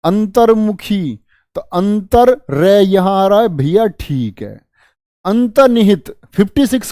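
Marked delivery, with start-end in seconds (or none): 0.0-1.2 अंतर्मुखी said slowly, the र clearly sounded; slow model word with a crisp r; clear demo pronunciation. Shown as a demonstration. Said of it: "अंतर्मुखी बोल करके देखो इसको".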